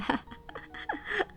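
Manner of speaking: airy high giggle at her own mistake; breathless giggle. Real, not acted. Host laughing to viewers about forgotten mic, breathless giggle. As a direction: let out a soft, airless laugh.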